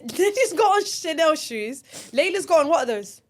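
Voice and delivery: high, loud mocking tone; mock posh amazement. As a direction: tease their fancy shoes. Mocking friends' fancy shoes.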